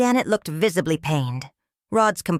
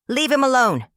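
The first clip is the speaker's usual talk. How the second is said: shouted from the audience, higher and louder; called out; 7 semitones higher, 4 dB louder than usual. A narrator's reading. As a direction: yell it across the studio. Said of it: "Someone called out".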